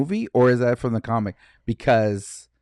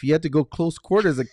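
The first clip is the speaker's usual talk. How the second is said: usual speaking voice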